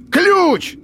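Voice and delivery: very high barked roar; battle roar. A narrator's reading. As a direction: roar the single word. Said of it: "рявкнул Фрост".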